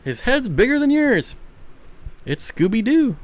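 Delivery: delighted gush at a big-headed pup; a burst of excited pet talk. Real, not acted. Excited at dog.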